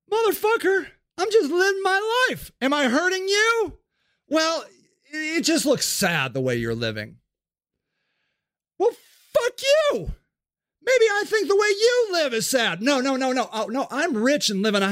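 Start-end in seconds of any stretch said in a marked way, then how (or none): 0.1-3.8 offended man yelling at a do-gooder; indignant yell. Acted. Sage yells back at clinician. 4.3-7.2 condescending. Acted. Condescending do-gooder voice. 8.8-12.8 angry yell. Acted. Sage yells back.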